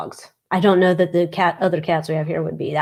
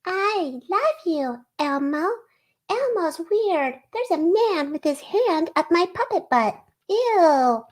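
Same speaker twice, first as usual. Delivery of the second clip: high, slow puppet voice; Elmo; 11 semitones higher than usual. Acted. Showing off accents to viewers, high-pitched puppet impression. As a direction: pitch it way up like a sweet little puppet. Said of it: "Or we could do Elmo".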